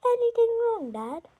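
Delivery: put-on too-sweet boy question; sweetest voice. A narrator's reading. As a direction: play innocent, sugary. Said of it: "in the sweetest voice".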